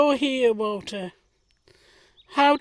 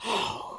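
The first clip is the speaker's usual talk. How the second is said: hurt gasp; 8 dB quieter than usual. Acted. Thomas hurt at being dismissed.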